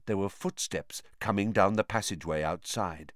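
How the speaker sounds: ordinary delivery, nothing marked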